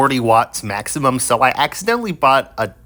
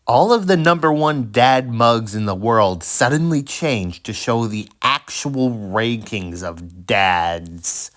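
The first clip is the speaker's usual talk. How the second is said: ordinary delivery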